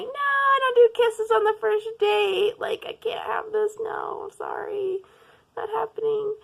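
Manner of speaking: high flustered refusal. Retold or imitated. Herself refusing her date's kiss, high flustered refusal. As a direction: squeal no while backing away.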